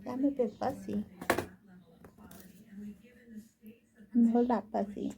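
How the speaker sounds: lightly put-on high baby voice. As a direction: speak for the baby, cutely. Mother answers as the baby.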